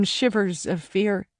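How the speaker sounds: plain reading voice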